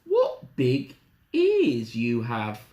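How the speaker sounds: put-on slow, wondering little girl. Little Red studying Granny's ears, slow wondering voice. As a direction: draw it out slowly, puzzled. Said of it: "she said slowly".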